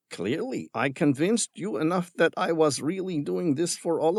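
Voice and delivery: mocking villain. A narrator's reading. Villain scorning Tom's concern for the creatures. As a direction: sneer at the tree hugger.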